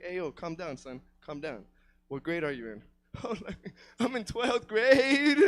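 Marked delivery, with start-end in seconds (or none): 0.0-2.8 low, soft, reassuring imitation; calming school staffer. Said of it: "she asked me, she's like". 4.0-5.4 slow, drawn-out tearful whine; mock upset wail. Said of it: "I was all fucking upset".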